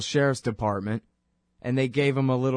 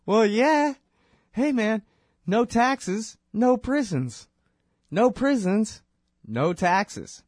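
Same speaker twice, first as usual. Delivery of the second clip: high, loud, gleeful insistence; excited Anthony; 10 semitones higher, 4 dB louder, slower than usual. Retold or imitated. Anthony telling the confused kid, high and gleeful. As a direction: hammer it home with glee. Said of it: "And Anthony said".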